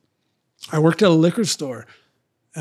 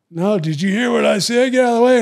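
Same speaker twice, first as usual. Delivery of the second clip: louder and higher, repeating the order like a bully; mock tough demand; 6 semitones higher, 6 dB louder, faster than usual. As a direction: push it like a guy who won't hear no. Retold standoff, repeating his demand.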